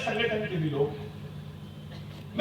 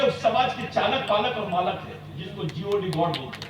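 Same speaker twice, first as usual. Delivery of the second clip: ordinary talk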